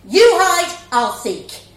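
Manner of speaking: loud, falling on seek; cheeky shouted joke; playful shout. Acted. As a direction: shout it mid-swing. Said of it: "One mum shouted".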